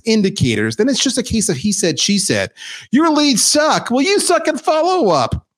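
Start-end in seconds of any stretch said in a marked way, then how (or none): 2.9-3.9 mock accusation. Acted sales versus marketing blame. 3.9-5.4 mock retort. Acted sales versus marketing blame.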